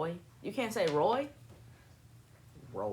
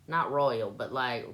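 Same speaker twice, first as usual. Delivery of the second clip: ordinary delivery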